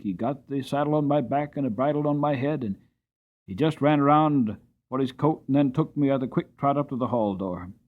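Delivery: usual speaking voice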